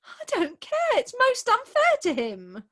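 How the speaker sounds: high, loud indignant protest. Acted. Bride defending her absent fiance, high heated protest. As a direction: insist hotly on his fairness.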